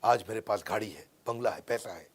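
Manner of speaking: low, soft film-hero quote; understated dramatic boast, pitched low; film dialogue. Acted. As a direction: drop the voice for a famous movie line. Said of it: "जैसे इसका famous dialogue आता ना".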